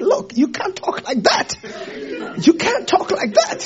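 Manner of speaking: a hint of a disciple scolding, half laughing; mock scolding. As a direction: scold him lightly, amused. Peter rebuking Jesus aside.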